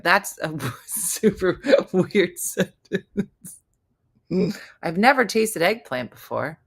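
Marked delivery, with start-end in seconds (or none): 0.0-3.6 slow, laughing through the words. Real, not acted. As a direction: laugh while saying it. Laughing at the odd comment.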